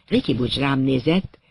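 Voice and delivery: ordinary delivery, nothing marked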